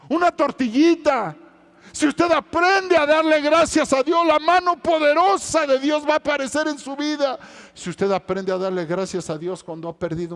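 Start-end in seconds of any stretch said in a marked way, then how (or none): 0.1-7.4 higher, louder preaching cry; fervent sermon peak; preaching at full force. Acted. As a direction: drive it home to the congregation. Sermon crescendo.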